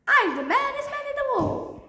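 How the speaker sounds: high, sing-out triumphant brag; high-pitched boast. Acted. Triumphant boast after handing over iPad.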